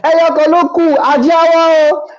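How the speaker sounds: high mocking crowd voice. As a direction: mimic the pushing buyers. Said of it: "they're like".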